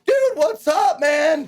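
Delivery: excited bro greeting backstage. Retold or imitated. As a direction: yell it like greeting an old bro. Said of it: "I was like".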